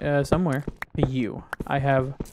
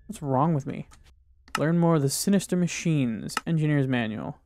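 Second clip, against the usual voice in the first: normal talking voice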